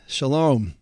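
usual speaking voice

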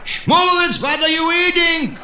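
high shouted calls from temple men; yelling. Retold or imitated. Temple men yelling at Shmulevitz, high shouted calls. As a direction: holler his name across the room. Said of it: "and yell".

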